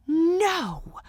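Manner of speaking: high, drawn-out cry of disbelief; stunned, high-pitched exclamation. A narrator's reading. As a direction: refuse to believe it, pitch leaping up. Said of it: "she said in disbelief".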